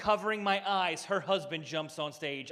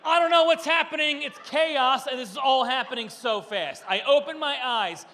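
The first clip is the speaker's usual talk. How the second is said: frantic retelling; 5 semitones higher, 5 dB louder than usual. Retold or imitated. Panicked retelling of the attack.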